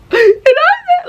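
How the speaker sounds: very high loud mock argument; mock shrill quarrel. Mocking the friend arguing back, very high loud. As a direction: squeal it like a fight starting.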